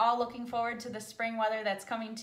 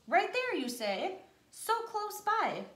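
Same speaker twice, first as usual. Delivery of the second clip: put-on startled child; 8 semitones higher, slower than usual. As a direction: jump at the sound, right there.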